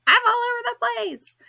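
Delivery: high, loud, flustered squeal; mock frantic exclamation. To Jaylene, explaining her intentions, a frantic high squeal. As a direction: throw it out like you're scattered everywhere. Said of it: "waving my arms all over the place and saying".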